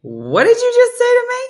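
very high, loud outraged yell; outraged retort. Retold or imitated. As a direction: yell it back in disbelief. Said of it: "I'd be like".

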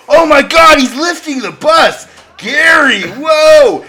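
loud hammed-up astonishment; amazed. Coworker watching Gary lift a bus, loud mock amazement. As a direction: gasp at an impossible feat.

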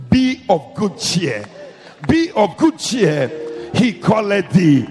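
slow, drawn out, loud call; loud slow proclamation. Retold or imitated. Crowd's words repeated, building.

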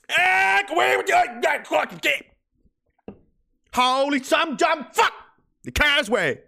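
put-on high, shouted Cape Breton accent. A mad Cape Bretoner cursing the causeway, shouted Cape Breton accent. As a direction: yell it in mock Cape Breton gibberish. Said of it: "you go to parts of Cape Breton and it's not even English".

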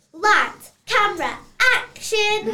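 loud director's cue to roll; calling action. Acted. Director restarting the scene, loud call.

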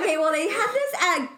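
ordinary talk, nothing marked

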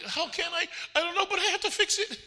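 exaggerated high-voiced self-defence; retold exasperated plea. Dad answering his wife about correcting daughter, mock exasperated.

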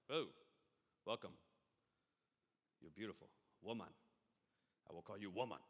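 deep caveman voice greeting the woman. Retold or imitated. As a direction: play a dim caveman meeting his wife. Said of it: "like, a caveman voice".

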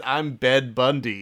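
"Bed Bundy" slow and deliberate, falling at the end; correcting again. Shown as a demonstration. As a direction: insist on "Bed Bundy", patiently. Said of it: "He says".

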